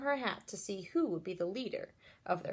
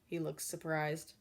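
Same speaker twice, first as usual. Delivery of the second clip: plain reading voice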